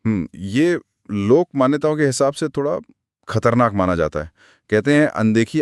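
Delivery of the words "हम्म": normal talking voice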